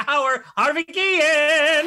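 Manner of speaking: booming welcome for the man of the hour; drawn-out announcer intro. Acted. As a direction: hype the guest's name like a game show host. Host introducing the guest.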